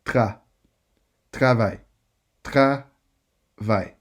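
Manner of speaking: "Tra" then "travail" in full, French r, ending in "aï"; building up the word. Shown as a demonstration. Teacher coaches a student through a hard French cluster. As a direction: break "travail" into parts, then say it whole. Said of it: "but you can do it".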